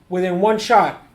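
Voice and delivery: ordinary talk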